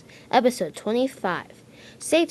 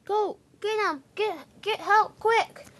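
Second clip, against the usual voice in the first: much higher, urgent plea for help; urgent high call; 11 semitones higher than usual. Acted. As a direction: hurry everyone along. Workman calls for help.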